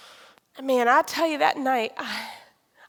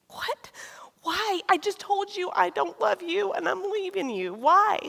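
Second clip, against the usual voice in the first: shocked disbelief; 8 semitones higher than usual. Retold or imitated. Her shocked reply to husband.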